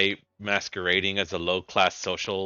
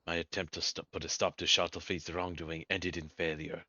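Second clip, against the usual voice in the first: low, soft and weary; low quiet character voice; 5 semitones lower, 10 dB quieter than usual. Acted. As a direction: speak low and grave, like a wounded ally. Voicing a game character.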